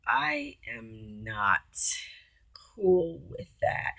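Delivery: slow hushed no; slow firm refusal. Retold or imitated. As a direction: drop low and draw it out, ending in a whisper. Her stern refusal, slowed.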